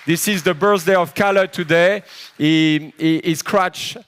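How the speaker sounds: loud, high, rousing toast; roaring party toast; excited shout. Real, not acted. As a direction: shout it out to the whole room, full of energy. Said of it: "I need to spread this energy out".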